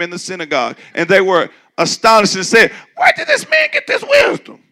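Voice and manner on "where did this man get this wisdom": squeaky high mock voice; shrill mocking crowd; high mocking townsfolk. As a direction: ham it up as gossiping townsfolk. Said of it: "That's how they said it".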